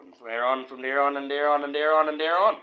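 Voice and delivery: repeating "from there on" with a steady climb; building repetition. Real, not acted. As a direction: pile it up, climbing with every repeat.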